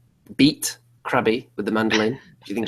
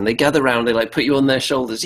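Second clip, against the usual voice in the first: ordinary delivery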